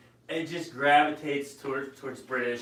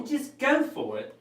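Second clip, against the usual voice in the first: high put-on Australian accent; hammed-up Aussie, pitched up; 9 semitones higher than usual. Cheering on a hesitant painter, Australian accent. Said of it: "that's my, uh, Australian accent".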